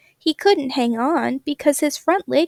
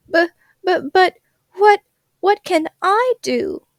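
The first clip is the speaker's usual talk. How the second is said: crying crocodile; 7 semitones higher than usual. Acted. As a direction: blubber it out, stuck on every word. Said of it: "crocodile began to cry too".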